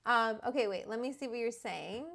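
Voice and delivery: usual speaking voice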